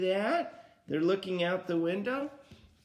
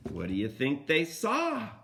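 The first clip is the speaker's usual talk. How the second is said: usual speaking voice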